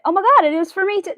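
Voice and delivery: high, loud, fast gasp; surprised. Retold or imitated. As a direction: blurt it out in shock. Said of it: "and I went".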